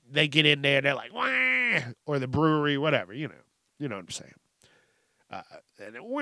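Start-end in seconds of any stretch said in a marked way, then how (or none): 1.1-1.9 high drawn-out mock cry; imitated baby wail. Host mimics baby arriving at the bar, mock wail. 6.0-6.2 long, high, held mock cry; imitated baby wail. Host mimics a baby disturbing drinkers, mock wail.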